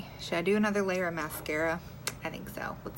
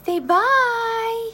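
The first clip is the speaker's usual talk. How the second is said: very high, loud sing-song; 14 semitones higher, 11 dB louder than usual. Real, not acted. Prompting baby goodbye.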